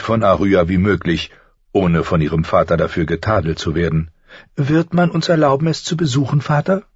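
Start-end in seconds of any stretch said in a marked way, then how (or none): 4.6-6.8 put-on much higher, pleading girl's voice; a young daughter begging her father; hopeful girl. A narrator's reading. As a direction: Sansa asks her father about the tournament, hopeful and high. Sansa asks her father.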